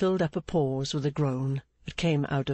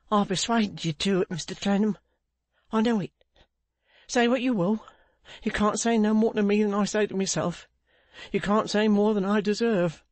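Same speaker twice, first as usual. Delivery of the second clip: higher-pitched, agitated confession; self-reproaching Pancks; 6 semitones higher than usual. Acted. Pancks owning his fault to Clennam, agitated self-reproach. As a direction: blame yourself in a raised, insistent voice.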